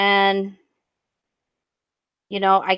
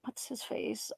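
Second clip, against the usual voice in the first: whispered to herself; whispering; 17 dB quieter, slower than usual. Real, not acted. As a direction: half-whisper while thinking. Trying to recall a poster name.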